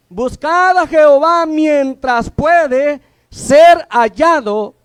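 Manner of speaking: loud, slow, ringing scripture read; preached scripture. Real, not acted. Preacher urging young people to seek God, loud and slow. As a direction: proclaim the verse to the back row.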